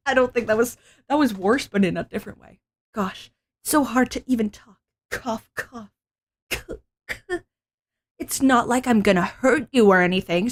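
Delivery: ordinary delivery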